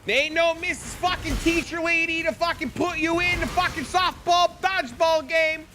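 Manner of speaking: mock a mean gym teacher picking on a fat kid; jeering high taunt; taunting bully.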